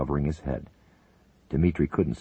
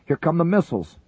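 much higher, loud warning cry; shouted warning; 13 semitones higher, 6 dB louder than usual. Acted. Buchanan warning the crew of missiles, loud shout. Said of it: "Buchanan shouted".

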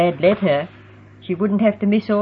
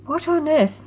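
surprised; 10 semitones higher than usual. A narrator's reading. Startled by Heather dancing her around.

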